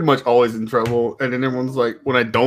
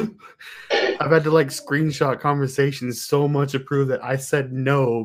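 ordinary delivery

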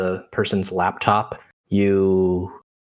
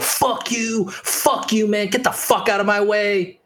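high-pitched, furious brush-off; angry outburst; 8 semitones higher, slower than usual. Acted. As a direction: cuss them out in a raised pitch. GM voices hostile NPC reacting.

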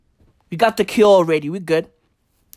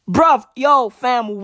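usual speaking voice

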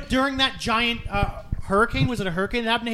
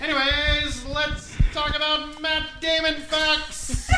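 higher, slow words breaking into a laugh; giggling; 8 semitones higher, slower than usual. Real, not acted. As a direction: try to say it without cracking up. Giggly pivot to Matt Damon.

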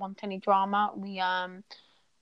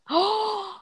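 high shocked gasp; surprised gasp; 10 semitones higher than usual. Real, not acted. Hears name of disliked youtuber.

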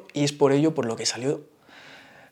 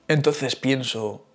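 ordinary talk

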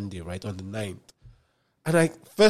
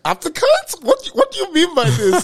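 shocked high squeal; 9 dB louder than usual. Retold or imitated. Outraged disbelief at boss.

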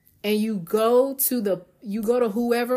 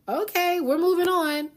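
much higher, chirpy mock dismissal; breezy brush-off; 10 semitones higher than usual. To the friend who won't ride, high chirpy mock brush-off. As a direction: toss it off lightly and move along.

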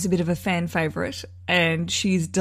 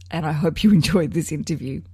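usual speaking voice

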